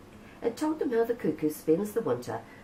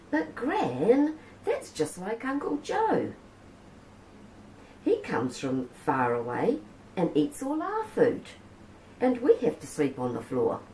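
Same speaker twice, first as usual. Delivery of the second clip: put-on child's voice; 7 semitones higher than usual.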